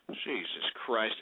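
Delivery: low, soft and slow; muttered aside. Real, not acted. Radio host exasperated, muttering low and slow. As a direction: breathe it out in disgust.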